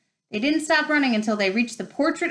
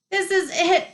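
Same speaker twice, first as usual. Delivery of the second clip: high despairing moan; 10 semitones higher, 4 dB louder than usual. A narrator's reading. Ron despairs at the locked door, moaning. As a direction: wail it as if doomed. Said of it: "Ron moaned".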